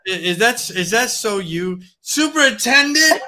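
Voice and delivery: high, slow incredulous question; lightly voiced baffled superintendent; mock incredulous superintendent. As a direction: drag it out in disbelief. Mock reply to the tenant.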